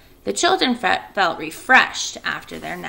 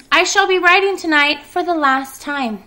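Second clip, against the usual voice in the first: high, louder spider voice; Charlotte's voice; 9 semitones higher, 6 dB louder than usual. Acted. Charlotte speaks after calling.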